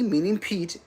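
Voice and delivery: ordinary talk, nothing marked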